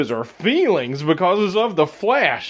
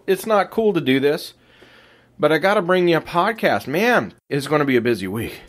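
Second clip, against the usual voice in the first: ordinary delivery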